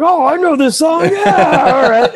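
hammed-up dad recognizing a song at a gig; clueless parent. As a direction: ham up a dad who half knows the tune. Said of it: "some kids' parents go like".

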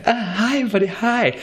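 high, gushing hello from a cute dog; very high, eager little dog voice; excited dog greeting. Retold or imitated. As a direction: squeal a happy hi to the whole café. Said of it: "did this really kind of like".

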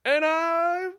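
high yelled no; yelling demo. Shown as a demonstration. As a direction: belt it out high. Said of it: "where you just yell".

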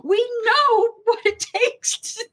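high, indignant reenacted yell; mock-furious shout; retold angry outburst. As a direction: tell off an intruder in a high voice. Acting out outburst at the doctor.

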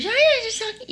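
mock sweet girly voice, way up high. Imitating the girl voice she used to find her voice. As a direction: put on the high polite girl voice. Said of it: "you know that girl thing we do?".

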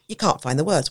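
ordinary talk

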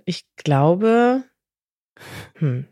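ordinary talk

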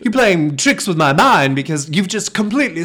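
mock bewildered outburst, high and loud; Bradley Cooper's character. Host playing the man baffled by switched accents, mock bewilderment. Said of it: "And he's like".

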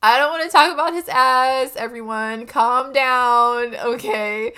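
high, loud laughing protest, flustered. Real, not acted. Flustered after verbal slip.

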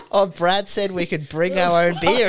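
high, loud, laughing mock drunk mate; a mate's excuse quoted while laughing. Retelling drunk mates, laughing.

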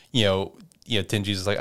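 ordinary delivery

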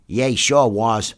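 slow, mournful agreement; mourning. Acted. The other enlisted man agreeing, mournful. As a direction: draw it out sorrowfully. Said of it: "mourned the other".

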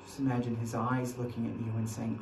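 soft, hushed guided imagining; quiet guided meditation. Acted. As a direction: draw them quietly into the scene. Guides an imagined scene at the cross.

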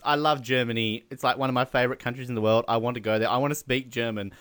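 ordinary talk

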